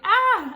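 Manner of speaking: Jamie's loud high imitated scream; a sudden frightened shriek, acted. Jamie showing Mom how he screamed at the boy. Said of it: "I was like".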